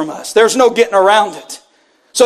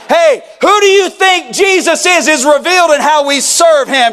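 fervent preacher, raised and driving; preaching at full force; 5 semitones higher than usual. Acted. Pastor pressing the church with a fervent call. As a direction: press the question on the congregation.

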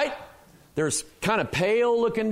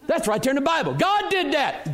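raised, higher-pitched preacher's conviction; emphatic self-quote; 8 semitones higher than usual. Retold or imitated. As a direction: build to a high, emphatic declaration. Repeats own reaction, building in conviction.

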